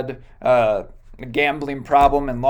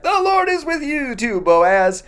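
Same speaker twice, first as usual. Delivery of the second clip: workers calling back; 10 semitones higher than usual. Retold or imitated. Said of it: "everyone yells back".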